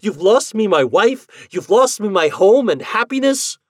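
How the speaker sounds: high, loud anguished accusation; crying out. Acted. Husband blames the ghost for losing his wife, crying out. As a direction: build to a loud, high lament. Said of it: "I cried".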